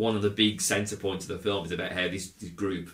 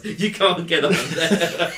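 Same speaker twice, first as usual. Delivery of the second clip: laughing; 8 semitones higher, 7 dB louder, slower than usual. Real, not acted. Laughing at film's safety mistakes.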